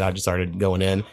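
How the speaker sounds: ordinary talk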